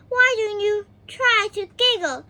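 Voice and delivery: Mister Happy urging a giggle; hopeful. A narrator's reading. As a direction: coax her gently. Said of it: "he said hopefully".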